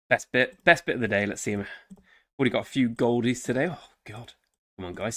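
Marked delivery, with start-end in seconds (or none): none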